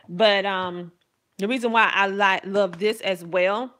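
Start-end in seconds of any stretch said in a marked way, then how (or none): none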